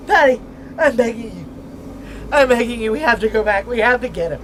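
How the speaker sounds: high loud begging shout; frantic begging; desperate pleading. Acted. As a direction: plead with everything you have. Pleading to turn back.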